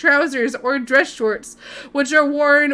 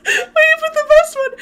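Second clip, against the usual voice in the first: high squeal through laughter; laughing; 13 semitones higher, 3 dB louder than usual. Real, not acted. Streamer teases the best law to come, laughing. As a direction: barely get it out for laughing.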